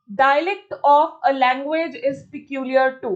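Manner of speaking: slow, deliberate dictation of the question; slow question reading. Acted. Teacher reads the exam question aloud slowly. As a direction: read each word out for students.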